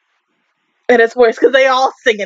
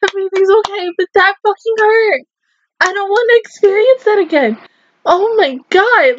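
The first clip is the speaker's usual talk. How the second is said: tearful; 7 semitones higher than usual. Real, not acted. Still shaken after crying.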